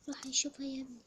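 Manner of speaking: ordinary talk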